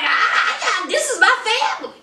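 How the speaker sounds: high, rushed stammering protest; hammed-up flustered plea; mock flustered outburst. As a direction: imitate a boyfriend in a panic, fast and high-pitched. Acts out flustered boyfriend.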